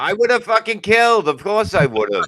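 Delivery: mock indignant boast. Comic scoffing at his friends' excuses, mock outrage. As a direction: act insulted that anyone doubts him. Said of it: "mock outrage at the excuses".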